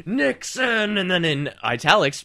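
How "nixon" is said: Nixon called out high and put-on; mock grumble. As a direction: grumble the name like a cranky forum poster. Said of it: "the first reply is".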